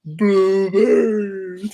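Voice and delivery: high, whiny pleading dog voice; voicing the dog. Dad voices his other dog begging for blueberries. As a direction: beg like a hungry pup, slow and high. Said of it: "He's like".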